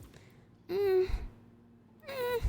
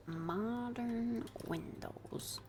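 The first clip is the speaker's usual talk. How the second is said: very slow unvoiced whisper, much softer; 4 semitones lower, 9 dB quieter, much slower than usual. Builder whispering the phrase slowly to herself. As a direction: breathe it out slowly.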